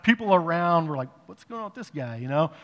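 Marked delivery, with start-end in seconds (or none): 1.3-2.2 puzzled bystander aside. Retold or imitated.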